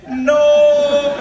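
high, loud, held cry; loud high drawn-out shout; frozen no. Retold or imitated. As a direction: hold the no like a paused frame. Bystanders frozen mid-shout.

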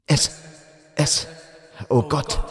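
broken, halting stammer on each word; stammering in terror. A narrator's reading. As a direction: stammer it out, too shaken to finish. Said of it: "stotterte der eine".

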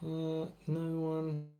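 slow, soft, lightly voiced Burns; a hint of Burns, drawn out. Burns voice again.

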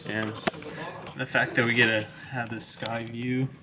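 quiet. Real, not acted. Talking quietly in a library.

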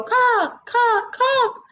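high clucking cock cock; bird call. Acted. Bushfowl calls to wake the sun, high clucks. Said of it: "she would go".

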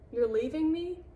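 high, loud, rising question; dismayed disbelief. A narrator's reading. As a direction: squeal it like you are being abandoned. Tied-up fox abandoned by Brownie.

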